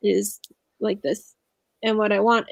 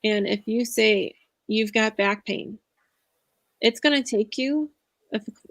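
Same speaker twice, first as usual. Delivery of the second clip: ordinary delivery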